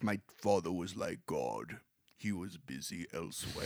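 low, ponderous impression of a wartime leader; Churchill. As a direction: drop deep and soft, pacing it like an old orator. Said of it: "obviously I'm doing the voice for Churchill".

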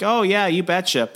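Minnesota bartender. Acted. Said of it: "And the bartender's like".

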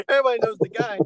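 high, fast, laughing exclaim; excited laughing. Real, not acted. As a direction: blurt it out laughing.

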